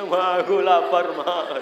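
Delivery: mock-tearful whine to mother; unclear crying. Child whose crying mother misunderstands, mock sobbing. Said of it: "karena ndak jelas nangisnya".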